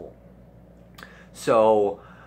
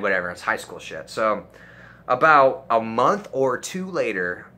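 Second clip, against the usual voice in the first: ordinary talk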